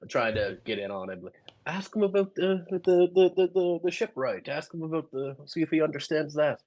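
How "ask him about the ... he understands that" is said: higher, louder, eager prompting; Davos's friend eagerly feeds him a question, higher and louder; eager whisper-shout. Acted. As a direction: egg him on, trying to get in on it. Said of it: "I'm like".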